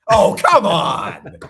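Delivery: loud drawn-out protest; exasperated shout. Real, not acted. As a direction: cry out in disbelief. Protesting basketball taken away.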